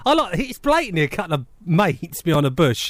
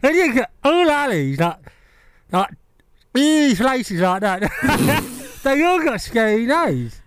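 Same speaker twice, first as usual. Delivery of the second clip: mumbling through a mouthguard; wearing a mouthguard; 3 semitones higher, slower than usual. Real, not acted. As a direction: speak round a mouthguard. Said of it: "Say again?".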